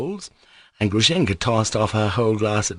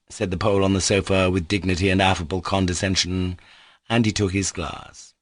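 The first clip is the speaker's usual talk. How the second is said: ordinary delivery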